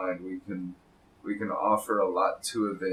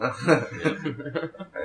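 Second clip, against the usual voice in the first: laughing; 5 semitones higher than usual. Pretend. Joking about being a tree.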